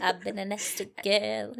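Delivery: creepy sultry quote; a hint of a creepy seductress; predatory menace. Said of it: "I sounded really creepy when I said that".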